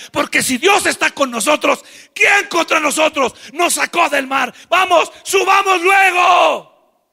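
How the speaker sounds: rally them to march up; rallying shout. Acted. Josué urges Moisés to take the land.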